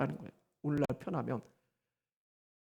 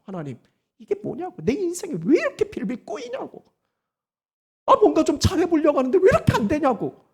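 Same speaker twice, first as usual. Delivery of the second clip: rising, louder aggrieved griping at God; mock complaint to God; 6 semitones higher, 4 dB louder than usual. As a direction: whine it out to God as if life is unfair.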